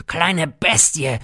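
a goat-like mocking bleat, teeth bared; bleating snarl. A narrator's reading. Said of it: "meckerte er zähnfletschend".

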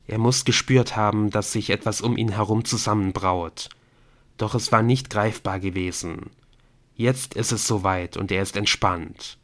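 ordinary delivery

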